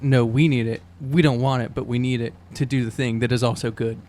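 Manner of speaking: ordinary delivery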